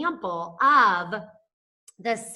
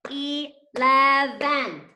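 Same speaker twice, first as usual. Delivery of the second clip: Eleven slow, louder, syllable by syllable; slow sounding out; 5 semitones higher, 5 dB louder, much slower than usual. Shown as a demonstration. Teacher sounding out Eleven slowly for the kids. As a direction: stretch Eleven into three beats. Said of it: "giving us three syllables".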